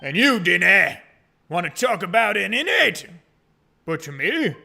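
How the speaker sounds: loud, put-on Scottish governor; hammed-up bad Scottish; attempted Scottish accent. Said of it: "do a Scottish accent".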